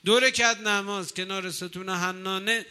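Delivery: usual speaking voice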